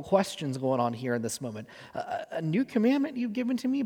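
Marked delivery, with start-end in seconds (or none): 1.9-3.8 high, fast, stammering disbelief; confused Peter. Retold or imitated. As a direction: Peter stumbling over the words, much higher and faster. Peter voiced, confused questioning.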